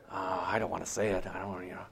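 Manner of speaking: embarrassed mumble. Acted. Embarrassed refusal to judge.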